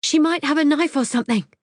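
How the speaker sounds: high, fearful warning; alarmed. Acted. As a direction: warn him urgently. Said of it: "I shout, alarmed".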